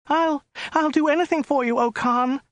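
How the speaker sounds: high, stammering, pleading woman; frightened stammer. A narrator's reading. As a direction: beg in high terror. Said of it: "stammered the woman".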